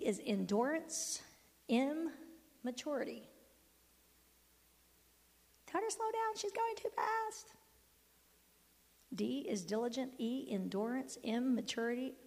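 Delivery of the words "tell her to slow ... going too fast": high, small, soft put-on voice; tiny high-pitched aside in someone else's voice; mock complaining listener. Mimics listeners asking to slow down.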